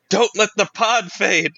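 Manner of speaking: urgent high yell, pitch up. Acted. Dying hologram man yells his last warning. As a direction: shout the warning as the signal fails. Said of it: "you can hear him yell out".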